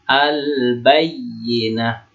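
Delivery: very slow "Al-Bayyinah", l sounded before b; clearly read lam. Shown as a demonstration. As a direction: stretch "Al-Bayyinah", holding the l and the yy. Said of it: "Dibaca ba-nya jelas ya".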